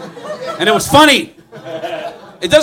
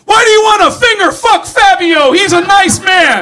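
much higher, loud mock shout; yelling stranger; 12 semitones higher, 6 dB louder than usual. Fan shouting at Gene about Fabio, high mock shout. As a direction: scold him like an outraged fan. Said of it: "they go".

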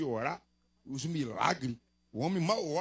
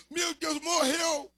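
higher mock cry of alarm; retold scream; 10 semitones higher, much slower than usual. Pastor retelling a bystander's cry, mock alarmed yell. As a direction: imitate someone yelling in fright. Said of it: "Alguém gritou assim".

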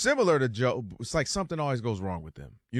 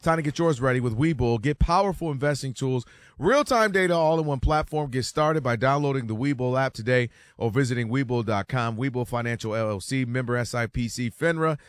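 upbeat radio sponsor read; 4 dB louder than usual. Acted. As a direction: sell it like a radio spot. Reads a sponsor ad.

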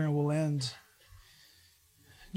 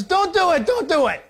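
higher, louder urgent pleading; pleading Moses; 9 semitones higher, 6 dB louder than usual. Acted. Moses begging God to spare the grumbling people, loud pleading. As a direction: beg God to stop, loud and high. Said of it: "And Moses says".

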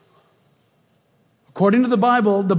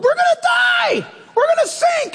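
much higher, loud panicked shriek; panicked screaming; 4 dB louder than usual. Retold or imitated. Preacher acting out disciples screaming in the storm. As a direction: shriek like terrified men in a sinking boat. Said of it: "All the guys are crying in the boat".

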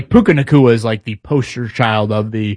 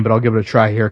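usual speaking voice

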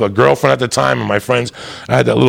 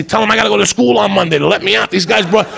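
frantic pleading; 7 semitones higher than usual. Retold or imitated. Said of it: "I say".

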